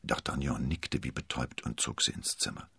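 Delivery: ordinary delivery, nothing marked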